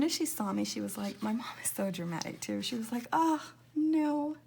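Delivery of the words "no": normal talking voice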